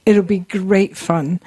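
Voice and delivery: normal talking voice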